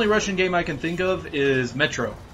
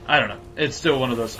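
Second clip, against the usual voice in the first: ordinary delivery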